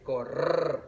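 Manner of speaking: Correr with a rolled rr; teacher demo of strong rr; strong trilled rr. Shown as a demonstration. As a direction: roll the double r hard in Correr. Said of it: "aquí está el sonido fuerte".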